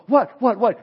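quick repeated alarmed "what", the first one loud; a worried friend jumping in, alarmed; alarmed asking. Retold or imitated. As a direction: fire off anxious questions, the first loud. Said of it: "You're going".

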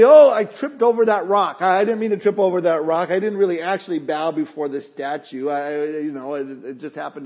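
hammed-up guilty believer talking his way out of it; mock flustered excuse. Preacher acts out a lame excuse.

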